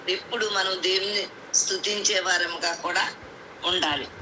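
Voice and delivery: ordinary delivery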